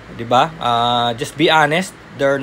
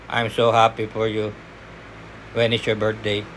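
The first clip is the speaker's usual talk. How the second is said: "I'm so happy for you. When is your birthday?" dropped much lower and softer, flat and dull; flat, wrong tone; 8 semitones lower, 6 dB quieter than usual. Shown as a demonstration. Trainer showing the wrong flat delivery on a call test. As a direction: mumble it low with no joy. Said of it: "hindi mo naman pwede sabihin".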